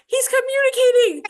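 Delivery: high, loud excited cheer; cheering a breakthrough, way up high and loud. Retold or imitated. Excited coach quote to mom.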